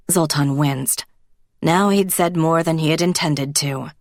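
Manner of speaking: usual speaking voice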